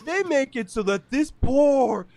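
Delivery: high, loud mock outrage, near a shout; a shocked anchor hamming up the horror; mock appalled TV host. Hosts denouncing the podcast's sketch, in high, loud mock outrage. Mocking Today Show hosts on air.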